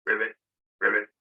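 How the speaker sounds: short croaky ribbit; frog ribbits. Shown as a demonstration. Teacher gives kids the frog call, short croaky ribbits. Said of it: "the croak of the frog".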